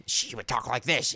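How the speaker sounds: put-on slow old-woman voice; elderly lady impression. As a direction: put on a drawn-out old lady's voice. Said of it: "then was Doctor Sue".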